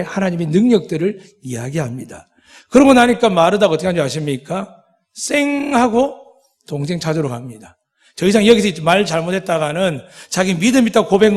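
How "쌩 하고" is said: high, slow swoosh on 쌩; whooshing rush sound. Retold or imitated. Preacher showing Martha rushing off, swoosh sound. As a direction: draw out 쌩 like someone zipping away. Martha rushes off to her sister.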